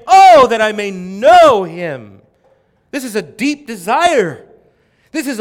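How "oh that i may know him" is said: slow, much louder, aching "Oh, that I may know him"; loud slow yearning cry; yearning plea. Acted. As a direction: cry it out with longing, drawn out. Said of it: "Paul yearning and longing and saying".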